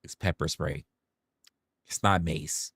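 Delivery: low, soft and unbothered; lightly played shrug, low voice; unimpressed correction. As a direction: calmly set them straight. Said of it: "And they're like".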